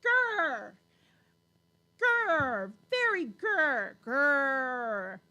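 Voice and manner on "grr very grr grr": loud, drawn-out pretend growl; mock angry growl. Playing the angry woman for viewers, mock growling. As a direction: growl like a play-acted angry person. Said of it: "Don't I seem angry?".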